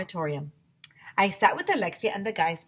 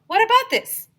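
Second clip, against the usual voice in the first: excited idea; 12 dB louder than usual. Acted. Kid pitching wild theory.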